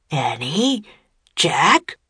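mildly scoffing questions; snorting disbelief. A narrator's reading. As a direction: question each name with doubt. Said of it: "the man snorted".

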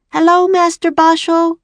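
put-on high, loud child's call across the yard. A narrator's reading. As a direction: call out cheerfully to the master. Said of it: "One called out".

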